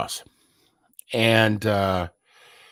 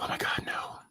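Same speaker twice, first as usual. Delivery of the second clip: whisper; 4 semitones lower, 10 dB quieter, faster than usual. Retold or imitated. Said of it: "They'd be like".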